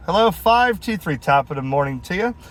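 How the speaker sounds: usual speaking voice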